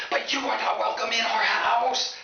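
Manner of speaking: high, slow, stretched-out yell; hammed-up high-pitched screaming; screaming parents. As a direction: shriek the rejection like an outraged parent. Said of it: "instantly start screaming".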